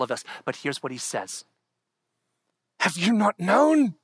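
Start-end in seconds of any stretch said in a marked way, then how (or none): 2.8-4.0 loud, higher scripture proclamation; preaching at full force. Acted. Pastor proclaiming Isaiah to congregation, full-force preaching. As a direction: thunder the verse out.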